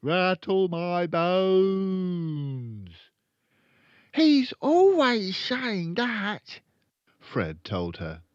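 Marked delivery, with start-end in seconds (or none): 0.0-2.9 loud, high, long-drawn spooky wail; high haunting parrot voice, very slow. A narrator's reading. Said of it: "a wonderful imitation of a spooky voice". 4.1-6.6 put-on high, loud, drawn-out little-boy voice; high-pitched boy character; young Fred. A narrator's reading.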